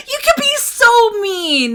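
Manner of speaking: high, drawn-out mock outrage; playful whine. Real, not acted. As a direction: whine it like a hurt friend. Playful outcry at character.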